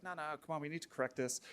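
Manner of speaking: ordinary talk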